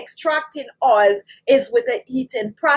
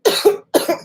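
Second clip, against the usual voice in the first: short dry throat cough; 7 semitones higher than usual. Real, not acted. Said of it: "My throat is itching".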